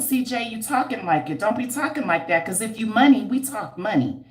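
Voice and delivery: ordinary talk, nothing marked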